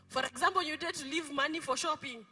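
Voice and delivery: normal talking voice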